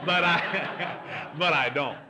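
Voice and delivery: real laugh breaking up the disclaimer. Real, not acted. Preacher taking back his joke to the congregation, laughing.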